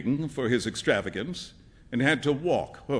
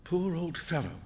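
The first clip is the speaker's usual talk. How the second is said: soft, pitying murmur; thinking to himself; 3 semitones higher, 7 dB quieter than usual. A narrator's reading. Young man pitying the beggar, musing to himself. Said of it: "he thought to himself".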